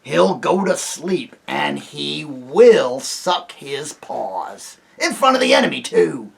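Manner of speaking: harsh character voice, slow and insistent; loud, exasperated aside; urgent stage whisper. A narrator's reading. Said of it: "whispered Trumpkin to Peter".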